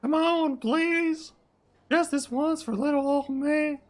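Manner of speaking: very high falsetto begging; high-pitched sidekick plea; pleading Flop. Acted. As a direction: wheedle in a high put-on voice. Flop begs to bring a friend.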